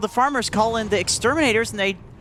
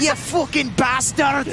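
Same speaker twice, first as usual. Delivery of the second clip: slow, higher, drawn-out Celtic swearing; hammed-up Scottish-Irish abuse; mock Scottish-Irish curse; 4 semitones higher, slower than usual. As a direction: draw out the insult in a put-on Scottish-Irish accent. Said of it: "A mix of Scottish and Irish".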